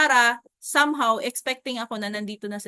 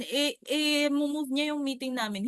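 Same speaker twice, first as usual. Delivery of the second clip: ordinary delivery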